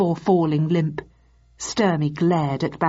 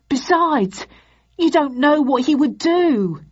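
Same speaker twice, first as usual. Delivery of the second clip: high, nervous whine; whining; 9 semitones higher, 4 dB louder than usual. Acted. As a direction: plead in a much higher whine. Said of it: "he whined".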